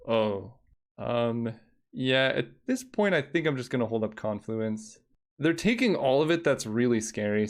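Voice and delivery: ordinary delivery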